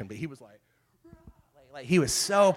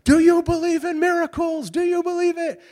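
high, loud, ecstatic announcer call; imitating announcer's exclamation; 14 semitones higher, 8 dB louder than usual. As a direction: shout it like the final buzzer just went. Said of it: "his famous thing is he goes".

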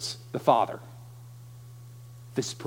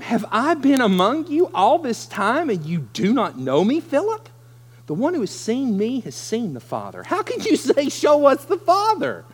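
higher, incredulous, half-laughing; exasperated disbelief; 7 semitones higher, 3 dB louder than usual. Acted. Jesus marvelling at Philip's question, exasperated and high.

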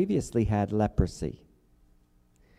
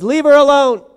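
commanding rebuke; 15 dB louder than usual. Acted. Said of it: "And he says".

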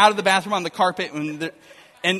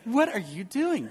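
incredulous; 5 semitones higher than usual. Retold or imitated. Wife baffled by towel on floor.

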